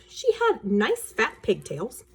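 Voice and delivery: usual speaking voice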